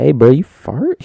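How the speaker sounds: loud, high classmate call-out; mock accusing. Classmate asking who farted in class, mock accusing. Acts classmate calling someone out.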